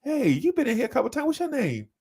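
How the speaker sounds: high, sugary sweet customer-service voice; extra friendly cashier. Retold or imitated. To a regular customer, high sweet cashier voice. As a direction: play the overly friendly register lady. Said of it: "You can".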